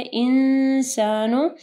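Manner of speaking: इंसानु with a long nasal hum on the n; slow इंसानु, humming before the s; ikhfa ghunnah. Shown as a demonstration. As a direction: hold the hidden n of इंसानु through the nose. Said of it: "इख्फा गुन्ना होगा".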